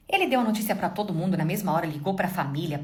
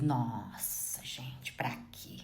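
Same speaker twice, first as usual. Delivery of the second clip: whispered aside; 7 semitones lower, 9 dB quieter, much slower than usual. Real, not acted.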